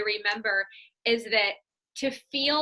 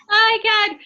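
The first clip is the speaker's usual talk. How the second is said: high, loud "oh my God"; exasperated exclamation; 10 semitones higher, 10 dB louder than usual. Real, not acted. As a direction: cry out at the tech mishap. Exasperated by internet trouble.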